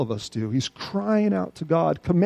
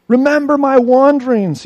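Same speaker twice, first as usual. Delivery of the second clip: a pleading cry, raised high and loud; 8 semitones higher, 9 dB louder than usual. Acted. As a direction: plead with God. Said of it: "he says".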